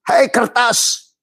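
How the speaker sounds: high, slow stretched call; mock call. Calling out to the paper.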